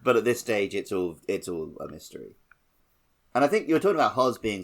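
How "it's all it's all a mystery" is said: ordinary talk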